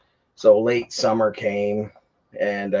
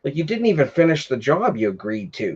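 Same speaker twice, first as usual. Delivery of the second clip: higher, sputtering angry retort; snapping at him; 6 semitones higher than usual. Retold or imitated. To the neighbour who wants money for unfinished work, angry snapping. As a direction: tear into him for the unfinished job. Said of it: "I just immediately snapped".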